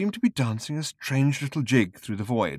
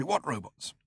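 sharply; 3 semitones higher, 7 dB quieter than usual. Acted. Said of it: "asked Seyford sharply".

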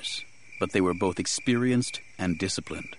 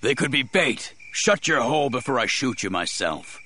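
desperate, raised snap; ragged, angry; 7 semitones higher than usual. A narrator's reading. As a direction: threaten them, angry and at your limit. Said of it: "His voice was ragged, angry, exhausted".

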